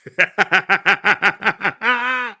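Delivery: high, loud burst of laughter; big cackling laugh. Real, not acted. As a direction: crack up out loud. Laughing at Cybertruck joke.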